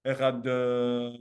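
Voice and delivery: slow, drawn-out "Echad"; stretched daled. Shown as a demonstration. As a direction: try to hold the final d of "Echad". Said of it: "someone wanna try to elongate a Daled".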